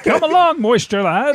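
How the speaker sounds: high, loud heroic call; superhero call. Acted. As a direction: summon your sidekick grandly. Sidekick hero call.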